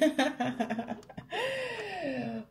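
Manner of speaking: long high laugh; drawn-out high laughter. Real, not acted. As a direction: burst out in a long, real giggle. Laughs at friend's greeting on phone.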